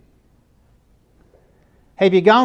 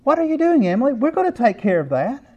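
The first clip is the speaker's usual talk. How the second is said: put-on higher, startled call to the child; surprised parent; 7 semitones higher than usual. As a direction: catch her by surprise, pitch jumping up. Parent finds Emily scrubbing wall.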